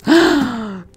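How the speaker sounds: sharp high gasp; shocked gasp. Real, not acted. Sudden loss in game.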